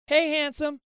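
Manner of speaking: high sweet wife greeting; wife's phone hello; mimicking wife. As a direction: lightly play the wife picking up. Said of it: "Bring, bring".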